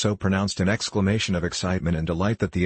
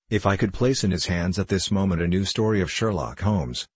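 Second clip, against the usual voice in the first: ordinary delivery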